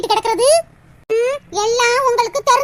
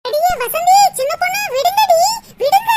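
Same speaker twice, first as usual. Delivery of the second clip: much higher, louder shout to stop the fight; frantic shout; 8 semitones higher, 4 dB louder than usual. Acted. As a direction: scream at them to let go. (சண்டையை நிறுத்தக் கத்துதல்)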